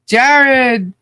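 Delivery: Jared called out high and loud; excited call out. Real, not acted. Host greeting a viewer in the live chat, loud calling out. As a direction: sing out the name in greeting.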